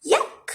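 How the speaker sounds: very high disgusted squeak; piggy yuck squeal. A narrator's reading. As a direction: squeal in disgust like a tiny pig. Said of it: "squealed the little pigs".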